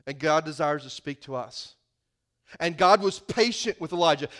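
usual speaking voice

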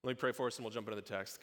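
ordinary delivery

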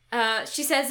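ordinary talk, nothing marked

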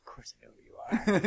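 breathy, unvoiced retelling of the line; hushed whisper. Recounting a movie scene to friends in a whisper. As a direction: whisper it like a secret. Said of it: "He's like".

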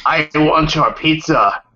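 slow, low menacing drawl; haunt them over pizza; spooky villain voice. Pretend. Spooky voice continues joke.

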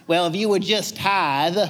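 lightly put-on Southern accent, teasing; mock Southern accent. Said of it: "I have to do a Southern accent with that".

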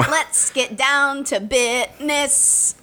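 drawn-out, slow pun; milk the pun slowly; slow punchline. Pretend.

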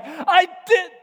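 high, loud comic protest; acted outburst. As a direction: play a flustered dad raising his voice. Dad answers bawling daughter.

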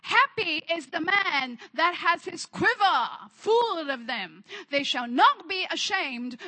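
loud, declamatory sermon delivery; emphatic preaching. Real, not acted. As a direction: preach it out with force on the key words. Preaches at full force.

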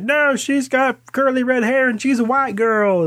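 high, put-on fan voice; mock outraged fan. Fans protesting the casting, mocked in a high voice. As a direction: squeak it out like an indignant fan. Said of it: "everybody's like".

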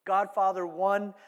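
ordinary talk, nothing marked

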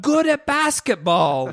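imitating the owner, high and loud; excited shopkeeper. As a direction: proudly toss out the only fact he knows. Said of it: "He's just like".

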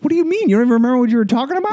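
fast, squeaky protest; high-pitched indignation. Acted. Accused of ranting, high-pitched fast protest. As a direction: fire back high and quick.

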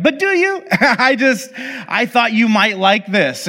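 a sly little jab, then innocent; teasing. Retold or imitated. Giver teases the cook.

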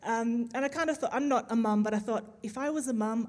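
normal talking voice

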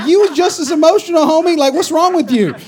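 high, teasing call-out; preacher teasing a crying husband; teasing rebuke. Retold or imitated. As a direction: poke fun at him. Mocking a friend.

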